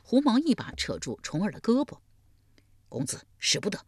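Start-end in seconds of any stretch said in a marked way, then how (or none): 2.9-3.8 soft, hushed warning; urgent whisper. Acted. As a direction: hold your master back quietly. Servant urgently stopping his master. (急切低声劝阻)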